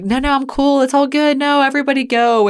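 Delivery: host plays Des cheerfully waving her family on; mock carefree brush-off.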